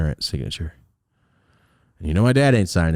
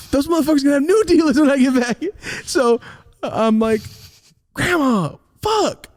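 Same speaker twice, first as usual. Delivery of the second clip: high exasperated; 3 dB louder than usual. Real, not acted. Upset, laughing retelling.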